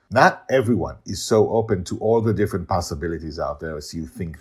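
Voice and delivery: mildly heated rebuke; angry. A narrator's reading. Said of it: "Colin tells Hugh angrily".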